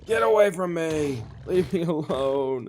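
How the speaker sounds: panicked yelling at attackers; being chased. Real, not acted. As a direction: yell it while fleeing. Chased by mobs, fleeing.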